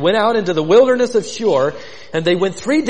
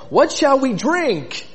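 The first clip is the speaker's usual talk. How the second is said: high, slow whiny complaint; grumbling complaint; 6 semitones higher, slower than usual. Retold or imitated. Israelites complaining to Moses, a high slow whine. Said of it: "they grumbled at Moses, saying".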